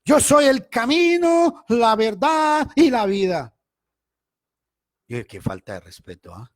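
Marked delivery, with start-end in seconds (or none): 0.1-3.6 much higher, louder, slow declaiming; mock prophetic proclamation. Imitating the pianist prophesying mid-worship, hammed-up high declamation. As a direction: boom it out like a self-proclaimed prophet. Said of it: "empieza a temblar el hombre".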